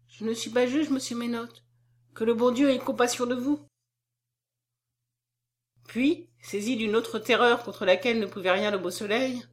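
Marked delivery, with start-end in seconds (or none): none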